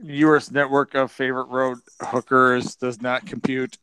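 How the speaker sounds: monotone robot voice. Acted. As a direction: recite it evenly like a machine. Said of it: "what has Robbie the Robot sound?".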